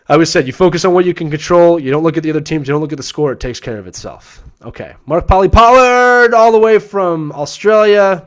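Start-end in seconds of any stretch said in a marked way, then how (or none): 5.1-8.2 high, loud, drawn-out ring announcer intro; announcer-style shout-out. Pretend. Host hailing a viewer from Australia, loud announcer shout-out. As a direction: stretch the name out like introducing a champion.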